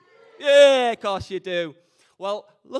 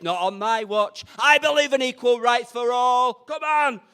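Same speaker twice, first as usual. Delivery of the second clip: mock rant; 6 semitones higher, 6 dB louder than usual. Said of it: "I said to my hubby-to-be".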